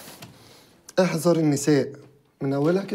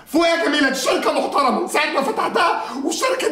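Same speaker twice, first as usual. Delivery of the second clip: higher, half-laughing reprimand; mocking scold; 7 semitones higher than usual. Owner mockingly telling the engineer to come to her senses. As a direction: tell her to wake up with a laugh.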